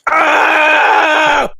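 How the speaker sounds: high, piercing drawn-out scream; loud acted scream. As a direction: let out a long, high "aah" at full voice. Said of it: "you just hear this".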